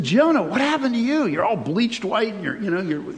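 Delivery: high, startled gasp of a neighbour; shocked townsfolk. Acted. Townsfolk staring at bleached Jonah, high and shocked. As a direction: gape at him in disbelief.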